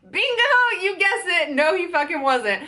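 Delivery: high-pitched game-show style reveal; sarcastic mock triumph. Real, not acted. Telling viewers he missed his flight, sarcastic mock triumph. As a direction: announce it like a sarcastic quiz win.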